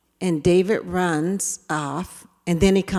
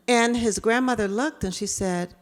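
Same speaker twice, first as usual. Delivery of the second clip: ordinary talk, nothing marked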